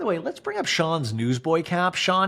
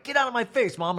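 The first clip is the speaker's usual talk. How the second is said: much higher whiny snap at mom; mock tantrum; 9 semitones higher than usual. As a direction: snap like a teen at her mom. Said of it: "And Monica's like".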